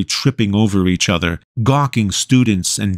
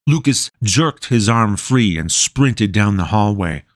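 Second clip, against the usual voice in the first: ordinary delivery, nothing marked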